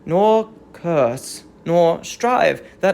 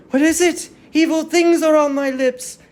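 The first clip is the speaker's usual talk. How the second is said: higher, louder, alarmed question; queen fearing the herald's news, higher and louder; 7 semitones higher, 4 dB louder, faster than usual. Acted. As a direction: she senses the worst and her voice jumps up.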